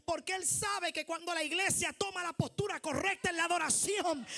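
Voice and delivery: usual speaking voice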